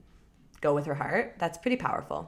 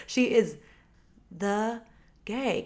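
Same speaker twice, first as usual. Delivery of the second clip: ordinary delivery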